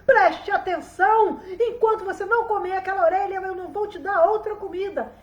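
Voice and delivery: high, loud scolding stepmother; furious. A narrator's reading. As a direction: lay down the law to a child, loudly. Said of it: "olhou pra ele furiosa e disse".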